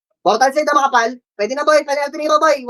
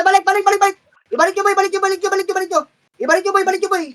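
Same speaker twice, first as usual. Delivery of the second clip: urgent callout; 8 semitones higher, faster than usual. Real, not acted. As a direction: yell them back into position. Urgent in-game callout to teammates.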